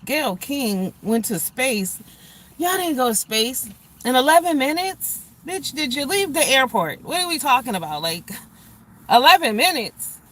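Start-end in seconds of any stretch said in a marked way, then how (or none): 2.6-10.2 incredulous rant. Real, not acted. Ranting, mocking the space trip.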